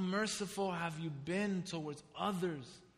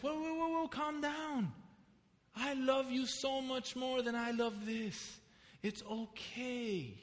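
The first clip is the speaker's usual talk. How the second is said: higher, softening to a hush, like a calming grandma; imitating a grandmother who soothes a crying child, higher and gentle; grandmother soothing; 6 semitones higher, 4 dB quieter than usual. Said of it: "my grandmother says".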